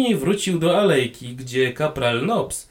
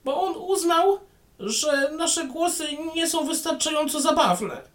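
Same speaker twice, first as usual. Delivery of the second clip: much higher, small gnome policeman; tiny policeman voice; 14 semitones higher than usual. Acted. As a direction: pipe it up like a tiny constable. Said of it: "powiedział mały policjant".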